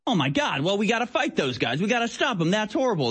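a lightly voiced panicky citizen; mock outraged reaction. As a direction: play the shocked hawk calling for war. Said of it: "the reaction's like".

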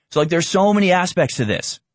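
usual speaking voice

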